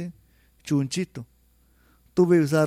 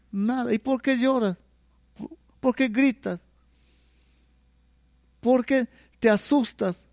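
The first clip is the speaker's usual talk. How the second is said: put-on higher, insistent parent questioning a child; worried parent; 8 semitones higher than usual. Parent asking the crying nine-year-old, higher anxious questions.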